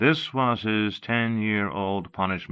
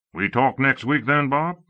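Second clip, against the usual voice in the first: hearty, friendly colonel voice; booming, jovial tone; big friendly voice; 7 semitones higher, 5 dB louder than usual. A narrator's reading. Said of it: "came the big, friendly voice".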